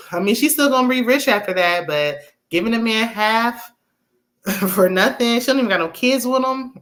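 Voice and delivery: usual speaking voice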